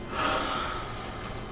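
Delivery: long soft weary sigh; heavy quiet sigh before acting; imitated sigh. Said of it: "and he's like".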